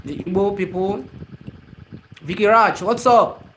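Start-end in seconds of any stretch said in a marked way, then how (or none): 2.2-3.4 excited greeting. Real, not acted. Greeting a live viewer.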